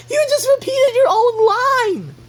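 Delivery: very high, squealing protest; mock outraged yell. Calling out a repeated line, high mock-outraged yell. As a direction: shriek it in exaggerated disbelief.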